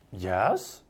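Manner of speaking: low, doubting "Yes" meaning no; sarcastic yes. Shown as a demonstration. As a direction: let "Yes" sink low in disbelief. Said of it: "in the inflection of his tone, the tone of voice".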